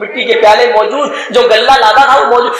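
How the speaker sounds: usual speaking voice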